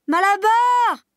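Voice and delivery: very high, loud desperate call; crying out. Acted. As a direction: call after him with all she has. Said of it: "cria-t-elle". (jument qui appelle Malabar désespérément)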